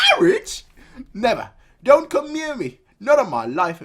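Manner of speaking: very high, loud outraged protest; shrill refusal. Acted. Man refusing marriage, shrill and outraged. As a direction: squawk it in horror.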